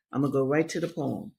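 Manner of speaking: normal talking voice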